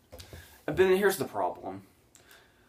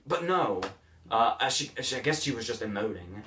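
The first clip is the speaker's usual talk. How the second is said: usual speaking voice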